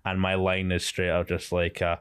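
normal talking voice

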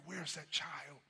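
ordinary delivery

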